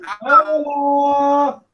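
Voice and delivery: robotic breaking-up call audio; garbled like a bad phone line; buzzy glitchy call audio. Real, not acted. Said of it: "he sounds like a robot".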